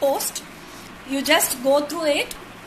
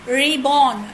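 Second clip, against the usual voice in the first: ordinary talk, nothing marked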